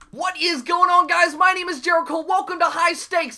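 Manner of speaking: bright upbeat YouTuber greeting; energetic intro. Real, not acted.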